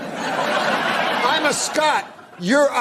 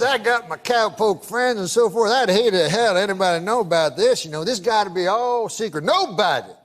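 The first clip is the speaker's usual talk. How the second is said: put-on Southern good old boy; 2 semitones lower than usual. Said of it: "I can tell by the accent".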